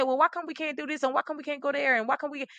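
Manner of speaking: put-on fast, high whining child asking why; whiny kid. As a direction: whine like a kid pestering a parent. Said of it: "we would say".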